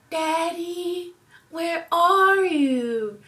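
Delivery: put-on high, slow child's voice calling for daddy; little kid voice. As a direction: play the small son asking for dad. Mom voices her young son.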